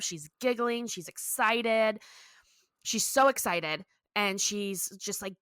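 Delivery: usual speaking voice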